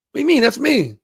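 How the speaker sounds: mock indignant woman. Reviewer plays Autobio answering the insult, high mock indignation. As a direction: voice her outrage up high. Acting exchange about business card.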